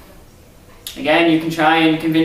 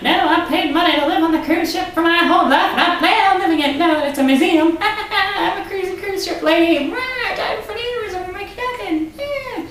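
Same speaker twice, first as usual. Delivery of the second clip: cruise ship lady. Retold or imitated. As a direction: ham up a weird ghost lady clinging to her cabin. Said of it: "That's how she talked".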